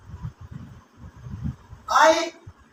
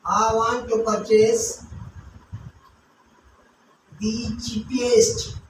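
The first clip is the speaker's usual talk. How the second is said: slow dictation; 6 semitones lower, 4 dB quieter, slower than usual. Shown as a demonstration. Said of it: "another sentence also you can say".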